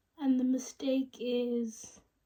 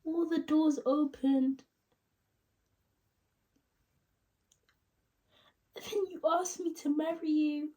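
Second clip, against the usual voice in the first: halting, higher tearful break-up confession; Rachel; 4 semitones higher than usual. Acted. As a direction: ache through long pauses, voice pitched up near tears. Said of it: "sad tone".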